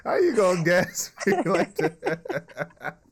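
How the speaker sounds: high, slow, laughing through words; cracking up while protesting. Real, not acted. Laughing at flattery.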